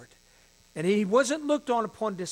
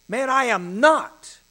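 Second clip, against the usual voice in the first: loud, high, drawn-out protest; acted indignant denial, much louder; emphatic denial; 6 semitones higher, 11 dB louder, slower than usual. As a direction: deny it forcefully, slowing on each word. Peter's denial acted in reading.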